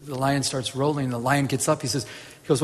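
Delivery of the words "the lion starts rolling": normal talking voice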